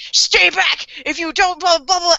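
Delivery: high, hammed-up threat; retold desperate warning. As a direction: act out a cornered man warning people off, trailing into nonsense. Retold cornered man's threat.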